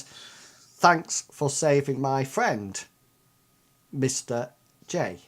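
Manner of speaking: slow, deliberate note reading. Acted. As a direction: read the note out slowly. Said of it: "it says".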